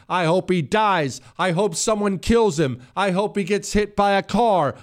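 lightly voiced mock Democrat wishing death; mock hateful partisan. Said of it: "heard them speak like this".

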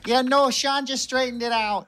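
put-on high Wisconsin accent. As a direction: upper Midwest Wisconsin accent, chirpy. Continues Wisconsin accent bit.

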